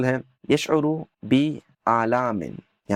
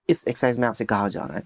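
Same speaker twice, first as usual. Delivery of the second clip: ordinary delivery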